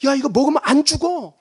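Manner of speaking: high-pitched acted coaxing as the snake; serpent's persuasive pitch; tempting serpent. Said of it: "뱀이 던진 유혹이 뭡니까?".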